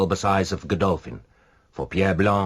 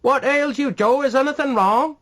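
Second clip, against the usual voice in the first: high, loud call from a window; 6 dB louder than usual. A narrator's reading. Neighbour at a window calling to Joe, loud and high. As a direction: call down the street, alarmed. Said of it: "a head looked out and said".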